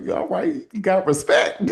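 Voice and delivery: breathy high yeah all right; playful impressed nod; tickled, half-whispered approval; amused approval. Retold or imitated. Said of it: "I'm like".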